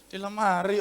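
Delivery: soft, low reply; quiet answer. Acted. Wife answering the third call, still quietly. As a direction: answer softly, without raising it. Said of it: "tapi pelan".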